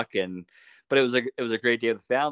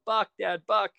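imitating son yelling; 11 semitones higher, slower than usual. Son spotting the buck, calling out to dad, high mock yell. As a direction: mimic his son's high eager shout. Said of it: "Denver's yelling".

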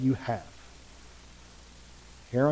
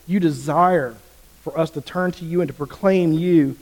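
tender prayer swelling to louder pleading; praying aloud; 2 semitones higher, 4 dB louder than usual. Acted. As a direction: lead the room in earnest prayer. Leads congregation in prayer.

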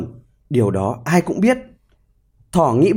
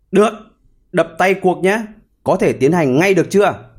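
ordinary delivery, nothing marked

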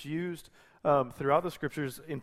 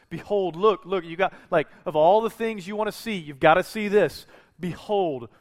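eager, lifted-up call to look; a touch of wide-eyed excitement; excited behold; 4 semitones higher, 7 dB louder than usual. Retold or imitated. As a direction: urge them to see it. Paraphrasing Matthew's excited behold.

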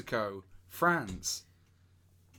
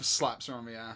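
ordinary talk, nothing marked

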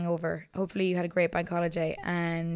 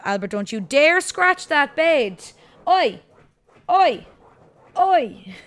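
loud, high shouted telling-off; sharp loud "Oi" to a naughty dog; scolding the dog; 7 semitones higher, 9 dB louder, slower than usual. Real, not acted. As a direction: yell at the dog to stop, slowing the first line. Scolding dog scratching the bed.

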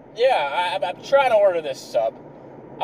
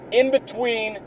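ordinary talk

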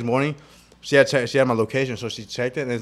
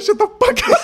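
very high, loud squeal of laughter; laughing; 4 dB louder than usual. Real, not acted. Repeating the sleepy outburst to friends, laughing hard. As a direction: squeak it out while cracking up.

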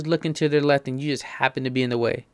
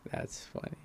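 whispered aside to himself; 14 dB quieter than usual. Real, not acted. Amused comment repeated to himself in a whisper. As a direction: breathe it out in a whisper.

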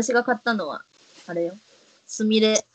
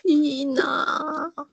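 envious drawl; 6 semitones higher, much slower than usual. Real, not acted. Envying friend's cream puff.

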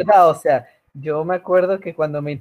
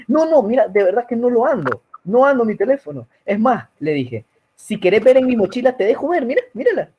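retold own pleading; 7 semitones higher than usual. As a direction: plead and insist, voice pitched up. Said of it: "Y ya le dije".

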